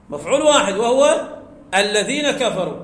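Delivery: ordinary delivery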